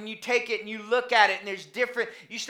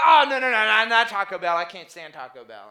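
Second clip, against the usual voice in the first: mock refusal; 3 semitones lower, 7 dB louder than usual. Friend rejecting Taco Bell.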